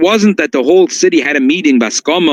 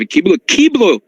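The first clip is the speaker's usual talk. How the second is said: "Kiblo, kiblo" slow and higher, each word leaned on; stress on kiblo; 5 semitones higher, slower than usual. Shown as a demonstration. Rabbi stressing "kiblo" to his students, slow and heavy. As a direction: drag out "kiblo" twice with weight. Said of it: "I emphasize the word kiblo".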